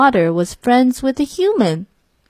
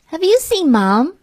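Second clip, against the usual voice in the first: put-on child voice; 8 semitones higher than usual. A narrator's reading. Child asking father.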